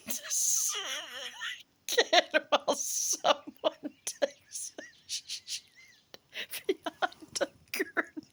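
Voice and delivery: high, breathy laughing whisper, slow; barely holding back laughter; giggling whisper. Real, not acted. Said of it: "I apologize for the laughter".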